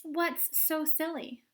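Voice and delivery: defiant. Acted. Violet defiant.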